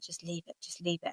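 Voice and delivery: quietly retold "just leave it"; husband calming his wife in a shop, softly; husband hushing. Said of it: "my husband's like".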